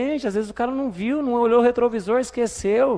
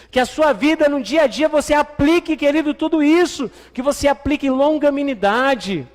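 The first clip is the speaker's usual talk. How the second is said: raised, driving sermon voice; fervent preaching; 5 semitones higher, 5 dB louder than usual. Acted. Pastor urging the church, fervent and raised. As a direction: preach it out to the congregation.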